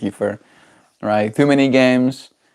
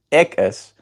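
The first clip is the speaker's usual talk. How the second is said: higher-pitched Cape accent "Ik is"; 4 semitones higher than usual. Shown as a demonstration. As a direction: show the K P accent on "Ik is". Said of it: "in the K P accent".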